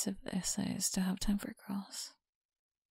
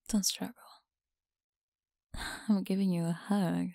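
normal talking voice

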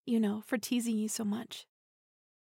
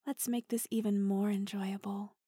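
normal talking voice

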